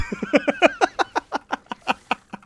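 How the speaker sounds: loud, very high laughing fit; high-pitched real laugh; burst of laughter. Real, not acted. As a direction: crack up at your own line. Laughs at his own excuse.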